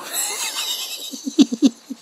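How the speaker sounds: high breathless giggle; high, wheezy evil giggle; villain giggle. Acted. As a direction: giggle like a gleeful madman.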